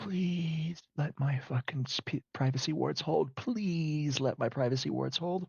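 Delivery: high, drawn-out begging; anxious pleading. Acted. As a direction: stretch the please like a desperate prayer. Jace begs his wards to hold.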